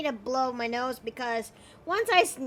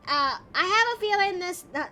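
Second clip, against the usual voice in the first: normal talking voice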